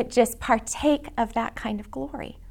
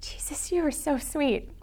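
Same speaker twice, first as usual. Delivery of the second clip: soft whispered prayer; 3 semitones higher, 8 dB quieter than usual. Retold or imitated. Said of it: "but just go".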